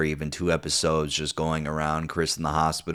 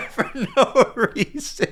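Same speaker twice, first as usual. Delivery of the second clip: high and slow through laughter; laughing; 4 dB louder, slower than usual. Real, not acted. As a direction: still cracking up. Laughing at the scene.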